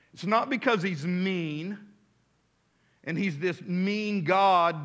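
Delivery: ordinary talk, nothing marked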